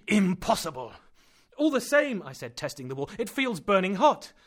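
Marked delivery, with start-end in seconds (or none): 0.1-0.9 louder, higher second insistence; emphatic repeat. Acted. Uncle repeats it more forcefully. 1.6-2.2 high and loud uneasy protest; worried insistence; anxious cry. Acted. Axel anxious about heat. 3.2-4.3 high and loud uneasy protest; worried insistence; anxious cry. Acted. Axel testing the wall.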